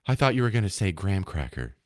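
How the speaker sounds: low, understated co-host reply; public radio parody. Retold or imitated. Co-host reacting to the muffin reveal, hushed parody. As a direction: answer like a sedate NPR skit host. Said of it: "talk like the delicious dish skit".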